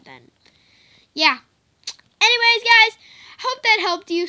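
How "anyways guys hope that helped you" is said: real excited shout; happy loud sign-off. Real, not acted. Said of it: "Sorry for shouting".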